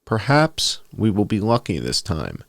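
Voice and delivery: normal talking voice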